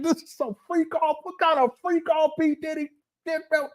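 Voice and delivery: high, laughing incredulous jab; mocking disbelief. Retelling his reaction to Umar, high mocking disbelief. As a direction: scoff at how freaky it sounds. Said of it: "I said".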